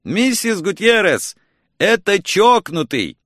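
high, excited narrated shout; yelling. A narrator's reading. Caller announcing himself to Mrs. Gutierrez, yelled. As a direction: call out with pitch jumping up. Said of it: "заорал я".

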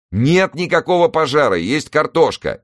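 high, loud insistence; exasperated. Acted. Newson denying fire to the operator, exasperated. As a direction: push the point home, pitched high. Argues with fire service on phone.

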